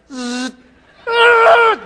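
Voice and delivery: buzzing zap noise; laser sound effect. Retold or imitated. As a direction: buzz jumping to a high, loud burst. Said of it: "those laser eyes".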